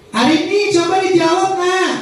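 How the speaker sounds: high sweet coaxing. Shown as a demonstration. Teacher coaxing a shy pupil to answer, high sing-song voice. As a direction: coax the shy child gently upward like a sweet song. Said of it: "Sementara suara saya begini".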